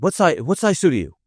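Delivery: a caller in a rush, asking quickly; panicked sister. Retold or imitated. Sister phoning in a panic, asks his suit size urgently. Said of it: "She's, like, in a panic".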